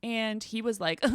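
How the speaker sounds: ordinary talk, nothing marked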